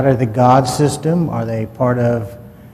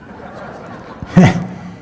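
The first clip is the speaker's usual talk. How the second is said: a quick breathy laugh at the punchline; short real laugh; 6 semitones higher than usual. Real, not acted. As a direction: laugh at dad's joke, lightly. Laugh after dad's rifle joke.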